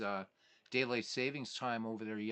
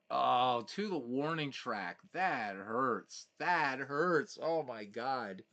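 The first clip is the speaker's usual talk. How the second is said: loud, high groan at a near miss; so close, it hurts; groan of disappointment; 4 semitones higher, 7 dB louder, slower than usual. Real, not acted. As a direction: ache over the near home run. Homer falls short at warning track.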